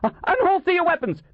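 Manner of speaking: high-pitched panicked order; frightened, high command; panicky yell. A narrator's reading. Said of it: "yelled in a panicky voice".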